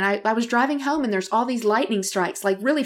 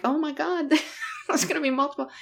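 higher, softer mock dread; laughing dismay; 6 semitones higher, 5 dB quieter than usual. Friend texts about rotation reports, laughing mock dread. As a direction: retell alarm through a laugh.

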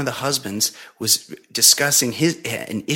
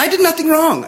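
indignant; 4 dB louder than usual. Real, not acted. Indignant protest of innocence.

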